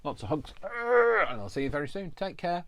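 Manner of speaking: sing-song goodbye. Real, not acted. High sing-song sign-off.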